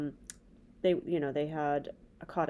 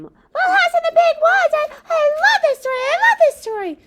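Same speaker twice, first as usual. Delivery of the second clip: high squeaky puppet voice, fast and eager; a chirpy little puppet character; 13 dB louder than usual. Acted. Puppet Bob speaks high in a put-on voice.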